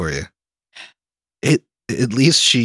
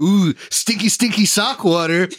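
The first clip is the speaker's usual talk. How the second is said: high, slow, hammed-up drink announcement; mock cocktail naming; 7 semitones higher than usual. Host parodying a friend's drink names, hammed-up announcing. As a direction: savor the gross name in a raised voice. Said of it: "like if Emily named a fucking rum and Coke".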